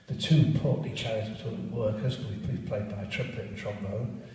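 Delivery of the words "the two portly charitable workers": ordinary delivery, nothing marked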